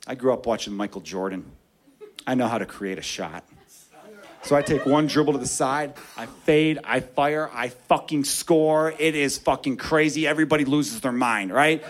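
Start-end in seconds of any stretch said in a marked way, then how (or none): none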